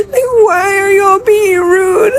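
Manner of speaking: tearful. Real, not acted. Upset traveler recounting her humiliation, tearful. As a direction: protest through tears.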